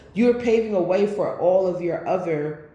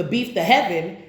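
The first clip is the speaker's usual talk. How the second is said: usual speaking voice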